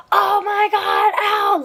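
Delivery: high, drawn-out mock wail; imitated pained scream. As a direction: mimic a passenger howling after banging his head. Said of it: "he was screaming".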